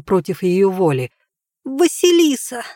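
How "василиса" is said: put-on high, reluctant child's voice; name forced out against her will; little girl voice. A narrator's reading.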